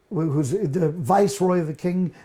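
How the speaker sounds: ordinary talk, nothing marked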